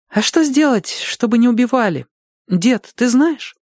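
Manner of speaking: put-on high-pitched child's questioning; questioning girl. A narrator's reading. Girl asking grandfather how to stop killing, high and earnest.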